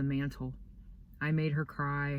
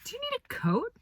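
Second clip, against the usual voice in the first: much higher, fussing teacher voice; concerned teacher; faster than usual. Retold or imitated. Boy voicing Missus B asking about his coat, high fussy voice. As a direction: mimic a worried teacher.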